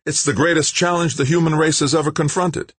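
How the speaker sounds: high, fervent declaration; passionate. A narrator's reading. Scientist answering the interviewer, fervently. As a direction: make it sound historic. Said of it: "became quite passionate".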